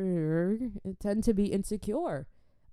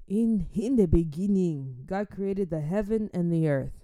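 reciting scripture; 3 dB louder than usual. Shown as a demonstration. Quoting Genesis properly, in a solemn reciting voice. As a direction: intone it like a church reading. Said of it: "One and one".

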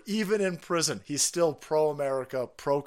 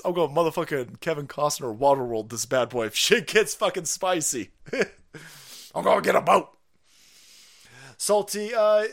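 ordinary delivery

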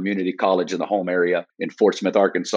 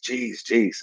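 choked out; 5 dB quieter, slower than usual. Retold or imitated. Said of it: "all I could get out was".

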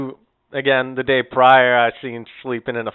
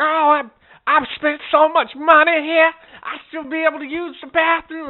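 put-on high-pitched indignant old woman; mocking, high old-lady complaint; complaining old lady; 4 dB louder than usual. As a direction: imitate an entitled customer protesting. Imitated old lady arguing over bathroom.

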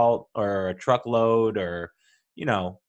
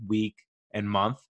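normal talking voice

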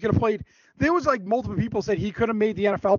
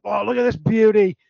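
put-on louder Australian accent, eager; Steve Irwin; 6 dB louder than usual. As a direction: imitate the Australian wildlife host marvelling at an animal. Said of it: "Oh, that sounded really good".

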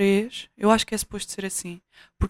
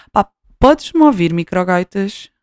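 calling out loud to the mic; annoyed yell at the microphone; 9 dB louder than usual. Real, not acted. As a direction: shout to check it hears you. Yelling at a faulty mic.

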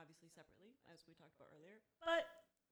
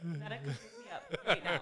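loud slow mock plea; 6 semitones lower, 15 dB louder, slower than usual. Mom's dramatic reaction, imitated.

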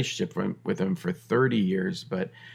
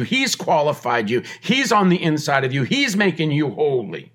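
usual speaking voice

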